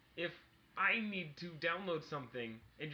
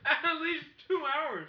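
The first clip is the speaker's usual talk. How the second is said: laughing; 7 semitones higher, 6 dB louder, slower than usual. Real, not acted. Incredulous laughing repeat.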